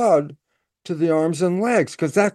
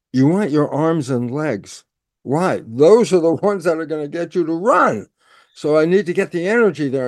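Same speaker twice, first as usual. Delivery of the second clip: ordinary delivery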